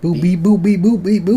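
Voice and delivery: high, beeping alien gibberish; mock alien beeping. Mocking a friend's B B boo-boo, high alien beeps. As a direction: chirp nonsense like a little alien. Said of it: "Were you speaking to aliens".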